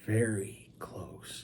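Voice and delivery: very slow, hushed whisper for effect. Real, not acted. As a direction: whisper it, stretching each word right out. Repeats it for effect.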